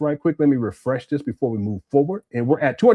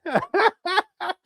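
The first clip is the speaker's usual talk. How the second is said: laughing. Real, not acted. High burst of laughter.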